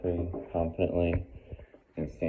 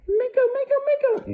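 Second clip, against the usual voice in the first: quick-fire squeals of the name, very high and loud; higher-pitched tone; 8 dB louder than usual. Shown as a demonstration. Trainer testing the dog's stay, high-pitched squeal. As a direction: squeal to tempt the dog up. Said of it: "A higher pitched tone of voice".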